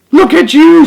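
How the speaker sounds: very high, loud cheer; proud shout. A narrator's reading. Wise old beetle cheers the young one's success, loud and high. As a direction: shout with pride and joy. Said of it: "shouted the wise old click beetle".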